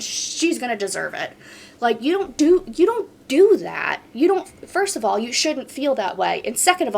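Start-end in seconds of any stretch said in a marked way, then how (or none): 1.8-5.2 raised pitch, louder, hammering each point; indignant scolding. Real, not acted. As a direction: lay down the rule, loud and indignant.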